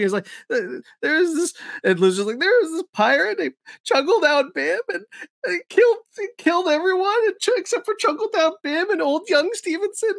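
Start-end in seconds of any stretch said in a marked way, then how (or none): 0.5-1.5 high-pitched imitated blubber; crying or laughing. Player retelling his disaster, half crying, half laughing. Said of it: "can't really tell if Lou is crying or laughing". 2.4-10.1 very high, squeaky mock blubbering; Lou Wilson crying-laughing. Player explaining the pirate to castmates, half crying, half laughing. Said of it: "can't really tell if Lou is crying or laughing".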